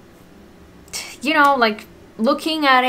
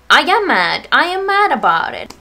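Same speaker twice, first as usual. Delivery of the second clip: emphatic, pitch rising into frustration; real anger; 4 semitones higher, 5 dB louder than usual. Real, not acted. Venting to viewers about not being accepted, angry. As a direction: insist on it, angry and punchy. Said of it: "Sorry, I'm getting mad right now".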